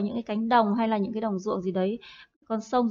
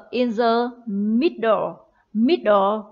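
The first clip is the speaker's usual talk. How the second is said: in the middle, said very slowly word by word; slow modelling; much slower than usual. Shown as a demonstration. Teacher models the phrase slowly for the class. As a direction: drag out in the middle for pupils to copy. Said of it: "Trọng âm ở âm tiết đầu tiên".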